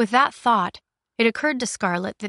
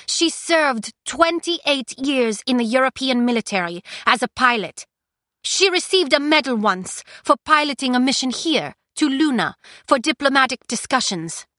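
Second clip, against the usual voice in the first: put-on higher, louder defiant young woman; defiant prisoner; 6 semitones higher, 4 dB louder than usual. Prisoner confronting the Queen, raised defiant voice.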